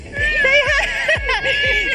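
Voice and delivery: high, delighted giggle; giggling. Real, not acted. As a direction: burst into a giggle. Reporter laughs on pier.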